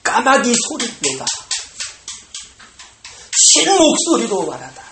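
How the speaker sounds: ordinary talk, nothing marked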